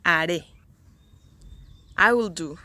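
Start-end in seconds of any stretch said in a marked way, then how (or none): 0.1-0.5 "haré" stressed on its final "ré"; stress on last syllable. Shown as a demonstration. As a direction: put the weight of "haré" on "ré". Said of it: "the intonation goes in the last one".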